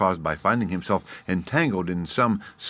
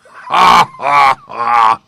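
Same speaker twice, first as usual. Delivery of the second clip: much louder, higher booming ha ha ha; roaring laugh; 6 semitones higher, 14 dB louder, much slower than usual. A narrator's reading. Lion roaring with laughter at the gnat. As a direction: bellow the laugh out. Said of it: "roared the lion".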